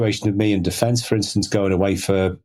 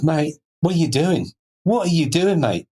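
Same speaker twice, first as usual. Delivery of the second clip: higher-pitched, exasperated questioning; indignant copper; 8 semitones higher than usual. Retold or imitated. Traffic copper to speeding driver, indignant mock telling-off. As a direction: act out a cop demanding an explanation. Acting out the copper stopping him.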